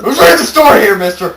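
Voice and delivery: high, loud mock outrage; indignant. Real, not acted. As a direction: snap at the interrupter. Annoyed at interrupting friend.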